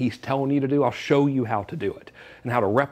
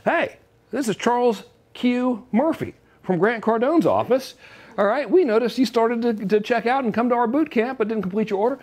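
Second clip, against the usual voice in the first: a peppy sales caller reading a friendly script; sales rep phone call; 8 semitones higher than usual. Acted. Sales rep calls a lead who left checkout, chipper and high. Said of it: "getting followed up with from the sales team".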